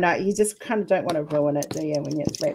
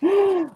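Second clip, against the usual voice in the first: surprised gasp; 12 semitones higher, 4 dB louder than usual. Real, not acted. Opens gift, amazed.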